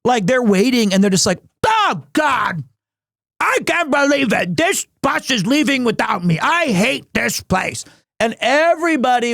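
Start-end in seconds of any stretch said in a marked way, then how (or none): 1.6-7.8 higher, whiny mock outrage; mad passenger. Stranded passenger complains about the bus, mock angry whine. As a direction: overdramatic angry traveller wailing.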